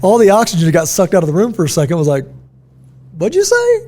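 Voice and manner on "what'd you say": very high, incredulous squeak; mock shock. Stunned room reacting to a teacher's comeback, mock disbelief. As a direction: act stunned, voice shooting way up.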